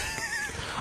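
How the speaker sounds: sudden high-pitched nervous giggle; a startled squeak of a laugh; caught-out squeal of laughter; high squeaky laugh. Real, not acted. Caller mocks his hosting.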